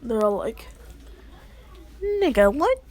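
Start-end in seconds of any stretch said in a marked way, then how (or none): 2.0-2.8 high, loud shocked exclamation; acted disbelief. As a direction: jump up high on a stunned question. Group reacting in shock.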